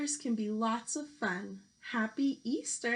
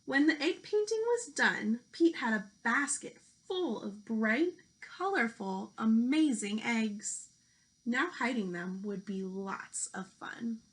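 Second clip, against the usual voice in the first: lively picture-book storytelling voice; reading to children. Acted. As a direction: read it out like a teacher at story time.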